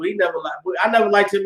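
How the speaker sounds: ordinary talk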